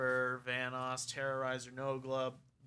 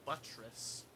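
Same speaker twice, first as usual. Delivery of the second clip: "buttress" hit on its first syllable; BUTT-ress, front-stressed; 3 semitones lower, 7 dB quieter than usual. Shown as a demonstration. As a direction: make the "butt" of "buttress" stand out. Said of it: "Emphasis on buttress".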